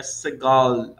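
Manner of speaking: SEGOL with a clear gaúcho L at the end; gaúcho-style final L. Shown as a demonstration. Teacher comparing it to gaúcho speech, final L held in Segol. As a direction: finish Segol with the tongue touching the palate. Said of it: "igual gaúcho falando".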